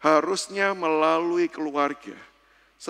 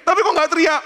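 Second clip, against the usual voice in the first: high, loud challenge; incredulous loud question; 11 semitones higher, 7 dB louder, faster than usual. Retold or imitated. Husband asking his labouring wife why no screaming, loud and incredulous. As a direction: fire the question back at full voice.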